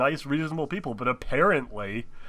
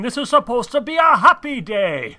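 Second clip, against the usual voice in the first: loud pompous voice; 8 semitones higher, 7 dB louder, slower than usual. Acted. Acted mayor line.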